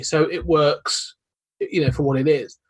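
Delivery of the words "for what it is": ordinary talk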